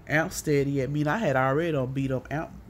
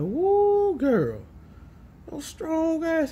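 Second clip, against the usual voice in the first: impressed astonishment; 13 semitones higher, much slower than usual. Retold or imitated. Man reacting to her punched wall, amazed. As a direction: imitate a man exclaiming in amazement.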